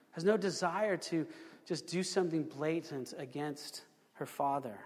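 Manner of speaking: normal talking voice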